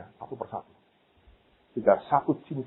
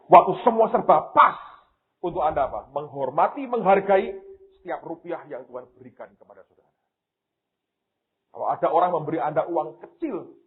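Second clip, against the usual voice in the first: normal talking voice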